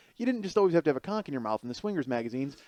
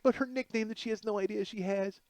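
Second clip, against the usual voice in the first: normal talking voice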